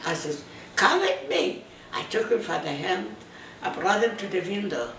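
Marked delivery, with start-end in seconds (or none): 0.8-1.6 "Come with me." pitched much higher, pressing; insistent repeat. Retold or imitated. Wife pulling her crying husband along, insistently. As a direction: say it again, urging him to follow.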